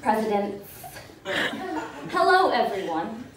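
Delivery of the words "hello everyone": ordinary delivery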